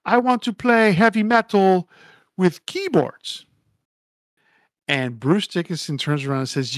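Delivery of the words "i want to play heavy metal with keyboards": high-pitched voice of a party guest; a put-on partygoer voice, pitched high; party guy's request. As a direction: pitch it up as a stranger asking a favour. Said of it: "he goes".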